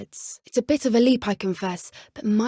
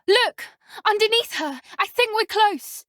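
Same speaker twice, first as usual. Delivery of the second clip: much higher, louder excited shout; much higher excited calling out; 9 semitones higher, 3 dB louder than usual. Acted. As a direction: point it out eagerly. Spotting something exciting.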